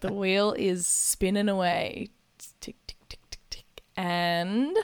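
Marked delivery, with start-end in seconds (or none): 2.6-3.7 soft rhythmic ticking under the breath; whispered ticking. Retold or imitated. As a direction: whisper tick tick like a spinning wheel. Spinning wheel ticking.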